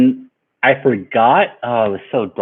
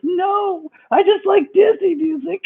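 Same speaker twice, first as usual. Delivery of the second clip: high-pitched mock wail breaking into laughter; embarrassed laughing protest; slower than usual. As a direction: protest helplessly through giggles. Embarrassed defence, retold while laughing.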